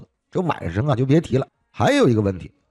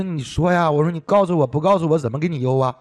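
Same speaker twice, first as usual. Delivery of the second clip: retold urging; 5 semitones higher than usual. Retold urging of a silent caller.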